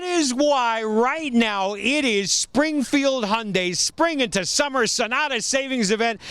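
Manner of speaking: slow, high hype announcer voice. Acted. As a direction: sell it like a radio ad. Hypes the sale event name.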